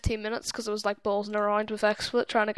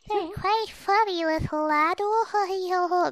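high slow put-on voice; silly voice; 7 semitones higher, slower than usual. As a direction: ham it up in a goofy voice. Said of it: "completely random there".

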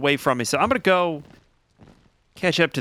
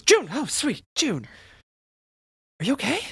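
much higher, alarmed calling of her name; startled friend; 12 semitones higher than usual. Acted. Oz waking on her shoulder, calling June in alarm. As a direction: jolt awake and fuss over her. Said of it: "Oz goes".

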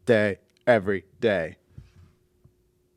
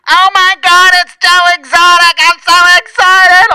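very high, loud ecstatic shriek; Richard Simmons; 15 dB louder than usual. Acted. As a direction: squeal every word out, slowing into the last. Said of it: "getting a call in prison from Richard Simmons".

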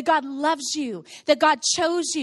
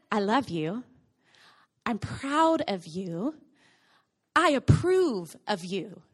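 slow tender voice of God; 6 semitones lower, slower than usual. Acted. Voicing God's words.

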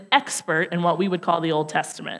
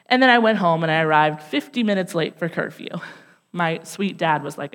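ordinary delivery

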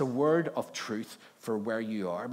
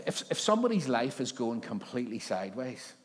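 ordinary talk, nothing marked

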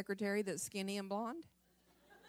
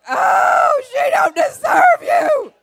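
much higher, louder, slow hammed-up wail; mock crying shout; 14 semitones higher, 17 dB louder, much slower than usual. Wife wailing at husband about her mother, mock crying shout. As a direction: wail it like a betrayed wife, laughing. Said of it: "I started crying".

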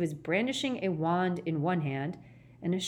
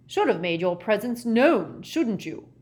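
louder, higher, combative; aggressive; 4 semitones higher, 6 dB louder than usual. Acted. Gaunt blaming the visitor, aggressively. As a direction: throw it at him as a challenge. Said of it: "said Gaunt aggressively".

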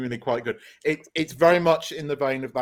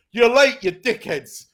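loud, punchy jab at latecomers; mock Peacemaker yell; 7 semitones higher, 10 dB louder, slower than usual. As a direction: bark it like an annoyed hero. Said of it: "And Peacemaker goes".

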